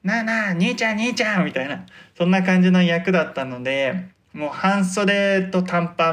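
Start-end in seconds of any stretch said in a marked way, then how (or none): 0.0-1.4 higher-pitched, lightly voiced punk hassling someone; a hint of an old-school street thug picking on a guy; eighties delinquent. The actor shows his stage punk role hassling a man, lightly voiced eighties delinquent. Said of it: "昔の八十年代の不良みたいな".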